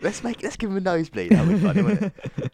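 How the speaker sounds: high, gleeful scheming voice; mischievous brother voice. Retold or imitated. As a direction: sound like a sneaky big brother. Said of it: "so he thought".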